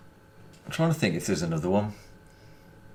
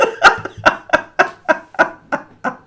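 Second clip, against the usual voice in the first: long, high, breathless laughter; hearty laugh; 7 dB louder than usual. Real, not acted. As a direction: burst out laughing at your own joke. Laughs at his own joke.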